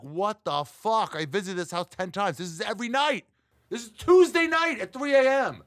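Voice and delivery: rising into a high, loud outraged squeal; mock angry neighbour. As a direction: build from annoyed to shrieking. Angry neighbour complaining retold.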